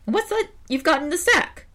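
very high crow voice. Acted. Crow eyes the bear's sack, very high. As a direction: squeak it like a nosy crow.

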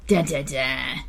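low spoken dun-dun-dun; mock suspense sting. Reader capping the Latin dictionary nightmare, mock suspense sting.

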